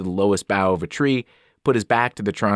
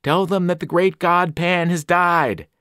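higher, slow cry from the shore; mysterious voice crying out; 8 semitones higher, slower than usual. Acted. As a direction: call the news across the water. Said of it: "crying out from the shore".

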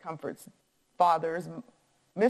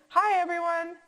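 high, bright-spirited hello; cheery greeting; 11 semitones higher, 4 dB louder than usual. A narrator's reading. Newcomers greet the seated family, high and cheery. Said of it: "they heard a cheery".

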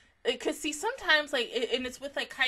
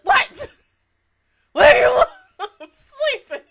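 loud startled yelp, then a laughing loud whisper; jolted awake, confused and loud; groggy alarm; 11 semitones higher, 18 dB louder than usual. Retold or imitated. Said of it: "and I'm like".

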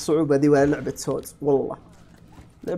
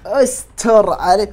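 higher, louder and very slow, begging for mercy; desperate plea; 6 semitones higher, 9 dB louder, much slower than usual. Real, not acted. Gamer pleading with the game as a monster closes in, desperate and drawn out. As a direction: stretch out "استر علي" high and loud like a panicked prayer.

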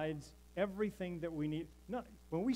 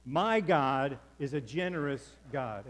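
louder, slower, full belief; with conviction; 7 dB louder, slower than usual. Shown as a demonstration. Pastor leading the congregation, said with loud conviction. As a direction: proclaim it like you mean every word. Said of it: "Now say it like you believe it".

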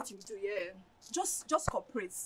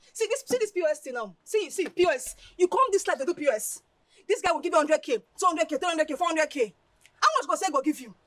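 raised, animated money talk; excited hype; 4 semitones higher, 4 dB louder than usual. Acted. Friend tempting Olivia with hundreds of thousands, excited. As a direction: build the sums up eagerly.

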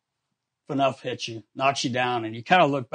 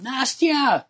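much higher call down the hall, far away; 11 semitones higher than usual. Retold or imitated. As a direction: call her name from down the hallway. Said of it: "I would hear him calling".

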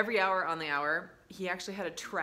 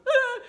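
sudden high loud little shriek; startled child yelp; 14 dB louder than usual. Retold or imitated. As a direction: squeal like a spooked toddler.

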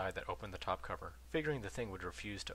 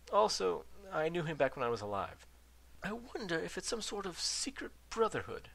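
babbling; 9 semitones higher than usual. A narrator's reading. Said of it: "the old ghost babbled".

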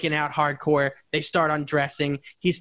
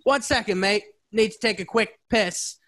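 higher, hammed-up British bloke; British accent; 8 semitones higher than usual. Host acts out the lyric guy in a higher British accent. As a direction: play the lyric guy as a cheeky British lad. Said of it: "do the accent".

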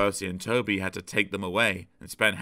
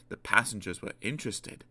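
ordinary delivery

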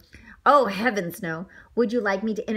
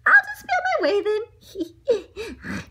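high, slow, hammed-up weepy voice; mock crying, drawn out in a high pitch; fighting back tears; 11 semitones higher, much slower than usual. A narrator's reading. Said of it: "fighting back the tears".

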